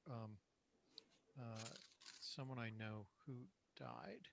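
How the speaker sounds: very faint, almost inaudible voice; barely audible. Real, not acted. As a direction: speak so quietly it barely carries. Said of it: "Your voice is very faint".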